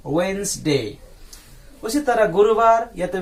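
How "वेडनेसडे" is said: वेडनेसडे said as the two-syllable "wensde"; teacher shows how to say वेडनेसडे; model pronunciation. Shown as a demonstration. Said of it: "क्या बोलते हैं इसको".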